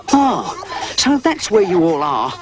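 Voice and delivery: high, lightly growled dog voice; a half-hearted growl; mock growl. A narrator's reading. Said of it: "growled Rhubarb".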